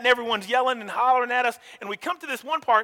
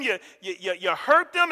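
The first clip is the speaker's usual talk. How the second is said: normal talking voice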